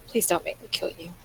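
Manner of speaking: low mumble, nearly whispered; mumbled under breath. Acted. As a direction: mutter it to yourself. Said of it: "I just mumble to myself really low".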